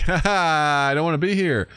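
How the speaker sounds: sarcastic half-laugh; mock awkward laugh. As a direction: fake a laugh, clearly miserable. Mock awkward imagined reaction.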